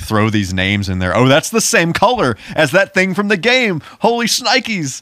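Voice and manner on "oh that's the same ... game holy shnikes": high, giddy fanboy gasping at references; mock excited fan. Mocking the movie's fans, high excited fanboy voice. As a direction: ham up a fan spotting game details.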